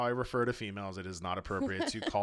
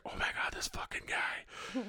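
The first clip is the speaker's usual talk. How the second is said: whispered aside; 9 dB quieter than usual. Retold or imitated. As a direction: whisper it in disbelief. Girl's aside about him.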